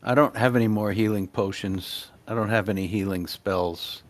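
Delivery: usual speaking voice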